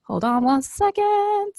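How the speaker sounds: high, drawn-out sing-song; slow sing-song call to hold on; sing-song stalling. Real, not acted. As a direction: stretch it out high and playful. Calling out to chat while reading.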